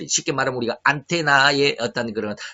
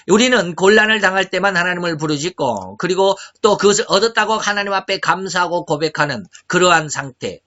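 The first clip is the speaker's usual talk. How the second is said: loud, high, ringing preacher's call; full-force preaching; 6 semitones higher, 7 dB louder than usual. Acted. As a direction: drive the point home from the pulpit. (강단에서 힘차게 외치는 설교)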